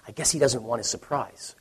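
casual aside. Retold or imitated. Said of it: "to Anna in a very normal voice".

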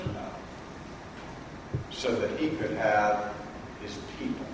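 barely voiced, intimate; very soft sermon hush. Real, not acted. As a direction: drop almost to a whisper. Hushed climax of sermon.

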